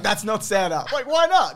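high, loud retold argument; mock outraged exchange. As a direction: blurt it out in comic disbelief. Re-enacting argument with son over Santa.

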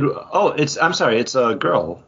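ordinary talk, nothing marked

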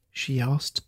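ordinary delivery, nothing marked

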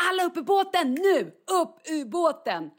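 high, loud re-enacted order; instructors shouting. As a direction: call everyone in urgently, as an instructor. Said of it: "börjar då instruktörerna ropa".

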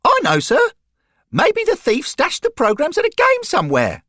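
put-on high, bright, innocent boy's voice; eager, guileless treble; unbroken voice, high and loud. A narrator's reading. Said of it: "in a bright, innocent voice".

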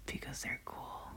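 hushed quick whisper. Real, not acted. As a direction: drop to a whisper. Whispered exchange.

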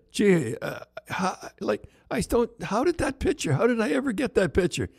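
high, hushed bafflement; fishing innocently for answers; puzzled wonder. Retold or imitated. Coach asks the bartender about the painting, high and baffled.

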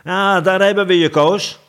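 loud, high gotcha; triumphant call. Acted. Smith and baker together call out to the king, triumphant. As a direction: shout it out as if catching him. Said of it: "riepen Wim en Frans tegelijk".

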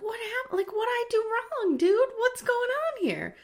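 confused Charlie pleading with the closed door; whiny confused boy. Retold or imitated. Charlie confused after door shut.

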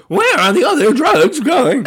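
high, slow, loud growl with a laugh; a hammed-up Batman voice. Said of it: "it's my Bat voice".

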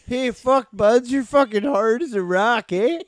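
high, loud hammed-up Canadian accent; mock Canadian. Mocking Canadian bedroom talk, high loud Canadian accent. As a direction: play the over-the-top Canadian lover. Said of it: "Canadian sex talk is incredible".

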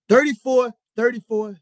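loud, high, worked-up repetition; heated. Real, not acted. Host listing the players' ages, heated and loud. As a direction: hammer out each number, loud and heated. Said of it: "I'm getting mad heated".

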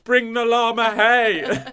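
slow, high, loud llama demand. Pretend. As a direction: drawl it like a diva llama. Animal voice continued.

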